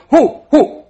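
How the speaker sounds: loud high huffing snort; spooked horse snort. Retold or imitated. Preacher imitates a skittish horse, loud mock snort.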